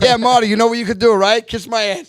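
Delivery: high, fast, loud retort to a prank caller; loud brush-off. Retold or imitated. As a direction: tell off a caller you don't believe. Retold brush-off of suspected prank caller.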